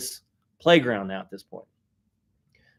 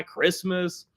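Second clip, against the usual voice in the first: ordinary talk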